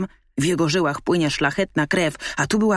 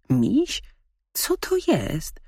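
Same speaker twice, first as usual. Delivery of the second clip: puzzled dog; 6 semitones higher, slower than usual. A narrator's reading.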